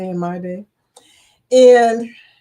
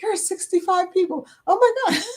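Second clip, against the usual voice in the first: excited disbelief; 9 semitones higher than usual. Retold or imitated. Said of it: "he kept looking and saying".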